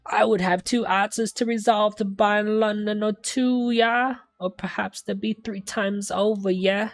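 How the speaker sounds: eccentric game defendant. Acted. Client haggling with two students over payment, pompous and high. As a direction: put on an affected London accent.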